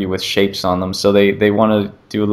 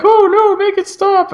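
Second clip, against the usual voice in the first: very high, louder mock panic; terrified lady; 4 dB louder than usual. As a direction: shriek it like the scared lady. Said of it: "and the lady's like".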